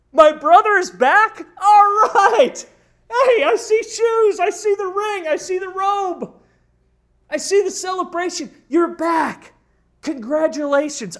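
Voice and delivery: high, loud, overjoyed welcome; the older brother cheering his brother's return; delighted brother. Acted. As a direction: burst out in happy surprise. Older son as he should have reacted.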